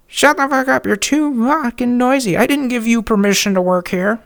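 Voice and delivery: high, loud screeching woman; high furious shriek of a rude neighbor; shrill yelling neighbor. Retold or imitated. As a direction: screech at him like an angry neighbor.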